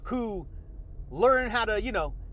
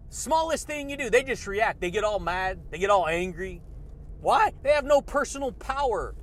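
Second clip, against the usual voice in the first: usual speaking voice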